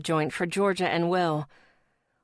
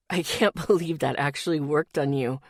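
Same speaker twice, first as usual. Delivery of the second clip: laughing; 4 semitones lower than usual. Acted. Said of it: "never stopped cracking up".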